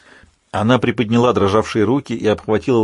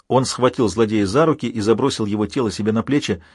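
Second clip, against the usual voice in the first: plain reading voice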